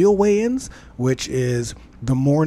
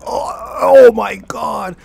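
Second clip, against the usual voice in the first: slow, drawn-out high shout of disgust; disgusted gasp; 8 semitones higher, 7 dB louder, much slower than usual. Retold or imitated. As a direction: recoil from a horrible smell, loud. Recoiling from the washroom smell.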